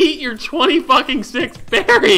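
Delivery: high, loud, slow outburst; exasperated yelling. Real, not acted. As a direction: yell each word at your friend. Bickering over food, outburst.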